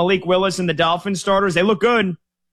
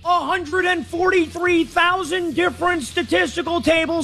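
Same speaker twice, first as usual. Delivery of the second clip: Ron Jaworski impression; 12 semitones higher than usual. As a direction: play the overexcited stat-crunching analyst, shouting it. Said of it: "that was like my Frank Caliendo impression of Ron Jaworski".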